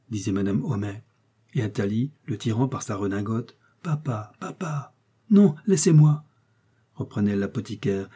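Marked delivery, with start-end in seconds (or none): 3.8-4.9 put-on small, soft, higher child's call; softly tug at father with the word; child pleading. A narrator's reading. Child tugging at raging father. 5.3-6.3 loud, higher-pitched brush-off; irritated rebuff. A narrator's reading. Enraged father brushes child off.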